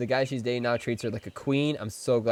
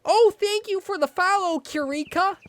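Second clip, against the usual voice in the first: very high, loud put-on voice; squeaky thanks; 5 dB louder than usual. Streamer replays thanking Kirika for follow, squeaky mock gushing. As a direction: gush in an exaggerated falsetto. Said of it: "we went back to the rope".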